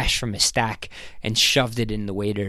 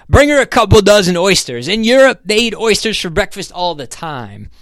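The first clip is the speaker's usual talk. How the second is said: high, loud, breezy overruling; insistent businessman; 9 semitones higher, 6 dB louder than usual. Acted. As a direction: order for her like a pushy host. Voices Ham overriding her refusal.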